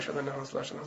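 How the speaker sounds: very soft, low mumble; quiet adhan. Retold or imitated. Boy lapsing in his adhan, very quiet. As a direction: let the words nearly vanish under your breath. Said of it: "he would say it quietly".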